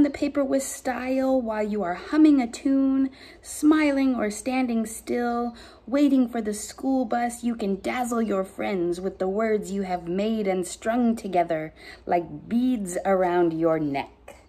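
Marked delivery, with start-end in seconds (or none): none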